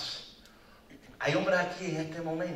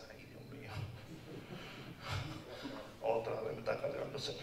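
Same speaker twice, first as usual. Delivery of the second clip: soft, breathy, embarrassed sheep voice; ashamed little sheep; 6 semitones lower, 12 dB quieter than usual. Pretend. The sheep to God, a soft, ashamed voice. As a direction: mumble it like a shamed lamb. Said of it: "Con vergüenza".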